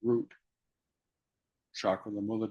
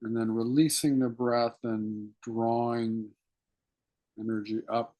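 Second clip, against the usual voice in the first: guided meditation. Acted. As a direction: draw them inward with hushed, patient instruction. Leads breathing meditation.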